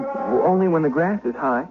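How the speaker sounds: put-on high, nervous young shepherd voice stumbling over words; frightened shepherd boy. Shepherd boy nervously answering the lady at night. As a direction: sound like a jumpy farm boy caught out at night.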